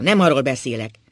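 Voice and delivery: loud angry insistence; heated. A narrator's reading. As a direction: stress NEM loudly.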